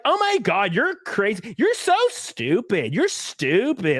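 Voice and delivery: put-on high, drawn-out Valley-accent scoff; mock Valley accent, stretching the insults. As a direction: scoff in an exaggerated Valley drawl. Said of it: "he has, like, a weird Valley accent".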